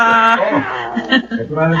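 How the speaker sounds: gentle happy laughter. Acted. Mother laughing about her one-year-old. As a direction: laugh fondly at the baby. Baby's birthday celebration.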